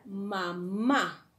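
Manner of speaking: "mamá" in two beats, the final MÁ stronger; teacher's "mamá", weight landing on final MÁ; stress on second MÁ. Shown as a demonstration. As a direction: separate "mamá" and push the last syllable. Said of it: "la segunda sílaba".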